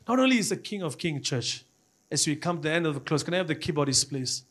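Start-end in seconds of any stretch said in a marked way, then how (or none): none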